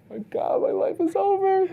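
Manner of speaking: hammed-up suburban kid panicking; melodramatic suburban kid. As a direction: wail it like a spoiled teen whose world ended. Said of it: "It's like".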